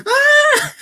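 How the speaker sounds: very high, loud laughing squeal; laughing shriek. Real, not acted. Host cracking up at the joke, loud high squeal. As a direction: let out a shrill burst of laughter.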